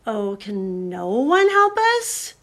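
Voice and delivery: a lightly acted despairing plea; wailing. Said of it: "wailed Lucy".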